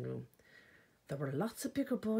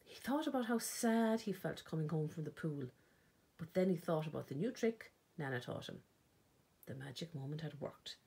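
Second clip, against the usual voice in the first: ordinary delivery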